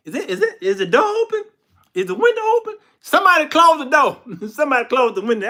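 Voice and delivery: very high, loud, comic alarm; hammed-up bewildered panic breaking into laughter; mock rising panic. Samson discovers his hair is gone.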